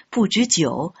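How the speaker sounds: usual speaking voice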